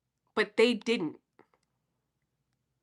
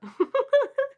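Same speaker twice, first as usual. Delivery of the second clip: high laugh. Real, not acted. Laughs at own story.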